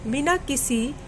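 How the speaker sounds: usual speaking voice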